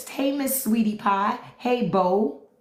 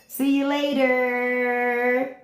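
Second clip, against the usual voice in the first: singsong goodbye; 4 semitones higher, much slower than usual. Acted. Singsong goodbye to banned troll.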